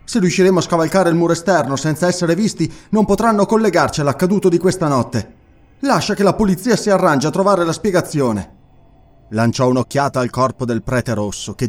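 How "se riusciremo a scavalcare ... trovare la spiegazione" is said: higher, louder, quick urging voice; urgent young noble. A narrator's reading. Murilo urges Conan to flee the trapped house, higher and pressing. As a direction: press them to leave at once.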